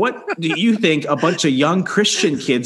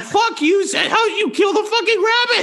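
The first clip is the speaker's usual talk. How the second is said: very high yelled accusation; mock dogpile shout. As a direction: yell at him like a mob. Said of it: "It's like".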